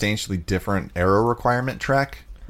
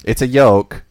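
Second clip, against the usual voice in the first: put-on loud, higher-pitched Spanish accent; Alonso impression; 5 semitones higher, 9 dB louder, slower than usual.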